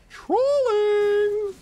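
high loud shriek; shrieked trolling. Real, not acted. Gamer shrieking at his own team. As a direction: scream it out in disbelief. Yells in disbelief at game.

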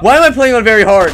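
loud, high frantic wail. Real, not acted. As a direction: wail in desperation. Frantic fight.

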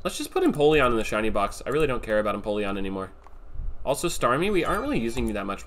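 normal talking voice

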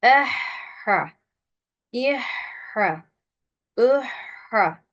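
"Ehha, ihha" with a long breathy h before the second syllable; thin vowels, airy mid-throat h in "Ehha, ihha"; breath-flowing throat h. Shown as a demonstration. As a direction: let air stream through the h of "Ehha, ihha". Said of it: "ses ve nefes akacak birlikte".

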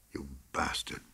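low, hushed, bitter curse; inner thought. A narrator's reading. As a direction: curse him under your breath. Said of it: "thought McComber".

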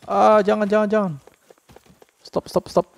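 playful panicked pleading. Real, not acted. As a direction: beg it to stop, half laughing. Enemy chasing the party.

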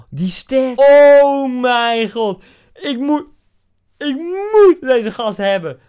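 high, loud, drawn-out amazement; awestruck shouting. Real, not acted. Gamer sees an incredible card's stats, awestruck shouting. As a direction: shout it high, stretching out the words.

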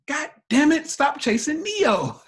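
high, loud exasperated outburst; exasperated mock yell. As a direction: cry out in exasperation, dragging the last words. Acts out exasperated answer to followers.

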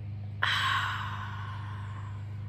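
demonstrating a calming breath out; long relaxing sigh; long slow exhale. Shown as a demonstration. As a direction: let the air go softly and slowly. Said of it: "breathe out".

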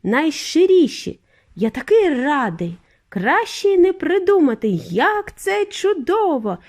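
overjoyed exclamations; delighted. A narrator's reading. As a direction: stretch the key words with glee. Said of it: "тішився він".

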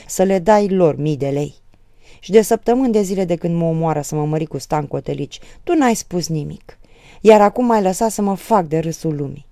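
loud, forceful accusations; angry reproach. A narrator's reading. Daughter blaming her mother for staying silent, angry. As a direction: round on her with bitter blame.

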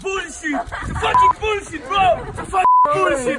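loud, high, furious shouting; outraged disbelief. Real, not acted. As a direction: yell it as if cheated. Spectator's angry disbelief at trick.

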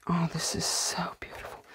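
soft, hushed whisper of wonder; awed whisper. Real, not acted. Opening a beautiful book for viewers, awed whisper. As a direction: breathe it out in quiet awe.

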